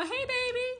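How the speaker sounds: high, cooed mock greeting; put-on sugary high voice; mock sweet-talker. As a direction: imitate someone gushing a pet name. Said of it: "I don't talk like that".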